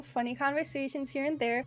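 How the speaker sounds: ordinary delivery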